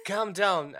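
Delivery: high, loud, firm order; urgent command. Acted. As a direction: cut through the panic sharply. Cutting through companion's panic.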